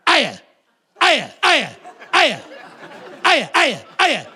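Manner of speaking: loud, sharp repeated yelps; puppy barking hello in bursts; yapping puppy greeting. Acted. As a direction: greet like an overexcited young dog. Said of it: "she stands there and she goes".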